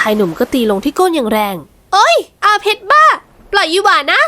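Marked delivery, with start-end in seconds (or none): 1.7-4.2 put-on girl's yelping protest. Young woman yelping at her spanking fiancé, high protest. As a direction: squeal it out in protest, high and drawn. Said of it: "ยังไม่ทันได้ร้องอุทธรณ์".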